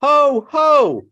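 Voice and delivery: loud, high ho ho chant; jolly ho ho ho. Shown as a demonstration. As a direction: belt out a jolly ho ho. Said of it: "repeat after me".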